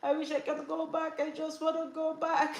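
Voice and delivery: high, whiny imitation of weeping; mock crying. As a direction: plead tearfully, playing at crying like a baby. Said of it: "cry like a baby".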